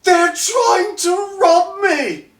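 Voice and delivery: very high, slow, shouted plea; a tied-up noble yelling for rescue; desperate shout. Acted. The bound noble cries out to the party for help, high and shouting. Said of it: "and goes".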